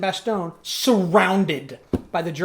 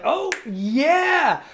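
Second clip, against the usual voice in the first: higher, louder whoop of relief; cheering; 10 semitones higher, 5 dB louder than usual. Pretend. Defenders seeing Gandalf arrive at dawn, a loud high cheer. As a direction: cheer like the cavalry just showed up.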